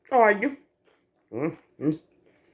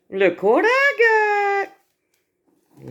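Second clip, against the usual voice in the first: drawn-out showing off; 9 semitones higher, 3 dB louder, slower than usual. Real, not acted. Showing off food to Mama.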